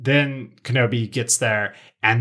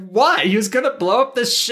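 high-pitched, louder retold protest; mock indignant outburst; 12 semitones higher, 3 dB louder than usual. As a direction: act out a character's baffled outburst. Retelling Anakin's indignant reaction.

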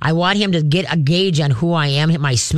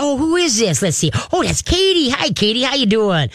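high-pitched, happy introduction for a dog; girly baby talk; 7 semitones higher than usual. Shown as a demonstration. Trainer models introducing a visitor to the dog, girly baby talk. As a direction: swoop up high to show the dog a friend. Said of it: "girly baby talk voice".

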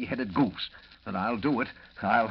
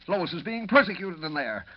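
upset outburst; 6 semitones higher, 4 dB louder than usual. Acted. Editor upset about trial.

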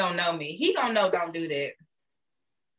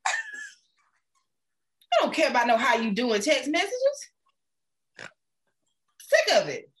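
laughing rant; 4 semitones higher, slower than usual. Real, not acted. Laughing rant about texts.

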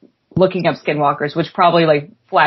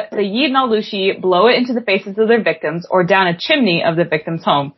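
ordinary talk

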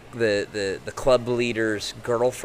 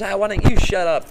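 high, louder mock shout; drunk shout back; 10 semitones higher, 7 dB louder than usual. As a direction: yell it back defiantly. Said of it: "Ben goes".

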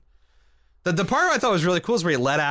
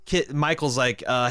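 ordinary talk

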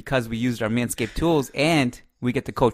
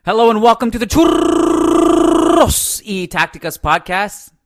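loud, high, slow host welcome; excited intro; 12 semitones higher, 8 dB louder, slower than usual. Acted. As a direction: boom out the show welcome. Enthusiastic podcast intro.

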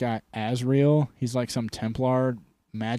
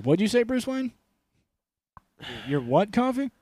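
mock suspicion; 8 semitones higher than usual. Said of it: "It's like".

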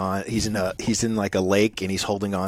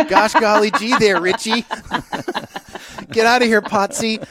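high, loud gee-whiz fifties voice; wholesome 1950s kid; 12 semitones higher, 7 dB louder than usual. Retold or imitated. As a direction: ham up a Happy Days kid. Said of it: "happened in Happy Days in nineteen fifty".

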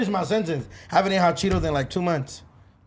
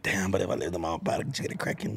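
whispering; 14 semitones lower, 10 dB quieter than usual. Retold or imitated. Whispering about sneaking girls home.

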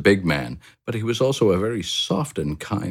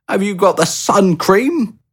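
much higher, louder frantic call; hurried call; 7 dB louder than usual. A narrator's reading. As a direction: yell it across the room mid-packing. Packing in a rush.

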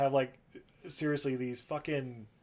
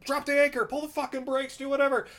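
much higher and louder, yelling commands in a hammed-up panic; mock captain shouting at the crew; panicked orders; 12 semitones higher, 7 dB louder than usual.

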